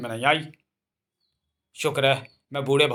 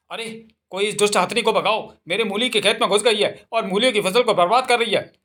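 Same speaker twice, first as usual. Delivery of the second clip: farmer's shout; 5 semitones higher than usual. Acted. Farmer yelling for someone to chase the elephant from his radish field. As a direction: call across the field for help.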